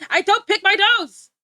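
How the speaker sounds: indignant shout. Real, not acted. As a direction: yell it like you've been falsely accused. Indignant denial to a friend.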